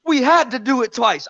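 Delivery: high put-on British voice quoting the boxer; mock British accent. Quoting AJ's line in a mock British accent. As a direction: quote AJ in his own accent. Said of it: "you do the accent well".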